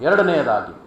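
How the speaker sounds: normal talking voice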